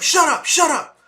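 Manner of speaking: loud high furious cop yell; cop yelling shut up. Retold or imitated. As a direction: shout him down like an angry cop. Cop silencing him, retold.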